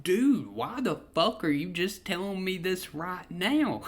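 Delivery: high-pitched, astonished retold question; incredulous question. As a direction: ask it in amazed disbelief, pitch up. Retold astonished reply to coming out.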